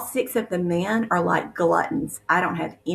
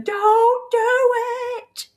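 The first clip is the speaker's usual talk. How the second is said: high, loud, desperate cry of warning; desperate scream; 5 dB louder, much slower than usual. Retold or imitated. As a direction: scream it as if to stop him. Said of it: "I'm screaming".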